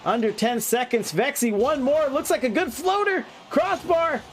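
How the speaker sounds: rising tension in the last seconds; excited final call. Real, not acted.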